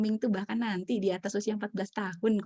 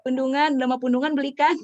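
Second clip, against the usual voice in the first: louder, higher mock exasperation; repeating the father; 6 semitones higher, 9 dB louder than usual. Repeating the father's words to the audience, louder. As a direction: lightly echo the father's complaint, punchy. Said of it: "gitu ya".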